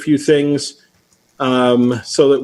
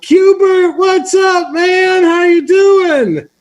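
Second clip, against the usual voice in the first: much higher, loud shouted hello; fake best-friend greeting; 4 dB louder, much slower than usual. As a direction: greet him like an old pal across the room. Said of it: "acted like he was my best friend in the world".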